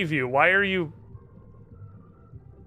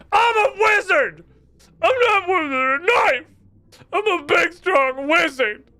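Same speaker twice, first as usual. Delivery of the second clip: shrieking high, much louder, slow tantrum; wizard tantrum; 7 dB louder, slower than usual. Acted. Wizard refuses to wield a knife, shrieking tantrum. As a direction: scream it like an offended wizard. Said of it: "I am Hector Vivian".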